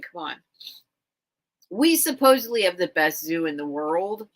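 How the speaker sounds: ordinary talk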